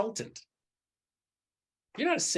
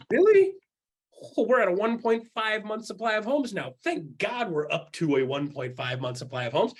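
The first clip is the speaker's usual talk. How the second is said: high, loud sarcastic "Really?" then dry mock gratitude; sarcastic mock relief; 4 semitones higher, 3 dB louder than usual. Agent mocking a panicked post, sarcastic. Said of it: "And I'm like".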